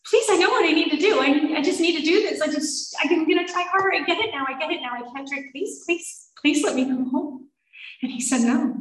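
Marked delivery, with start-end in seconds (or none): 0.0-7.5 high, breathless begging, words tumbling out; pathetic pleading. Retold or imitated. Patient begging husband to let her come home, pathetic pleading. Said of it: "I'm pathetic just like that".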